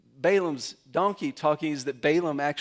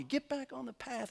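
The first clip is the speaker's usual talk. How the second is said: mock exasperated yell; 9 semitones higher, 7 dB quieter than usual. Balaam yelling at his donkey.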